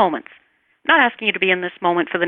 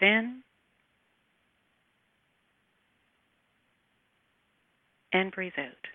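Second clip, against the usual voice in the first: guided meditation. Acted. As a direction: gently guide the listener through each breath. Leads a breathing exercise.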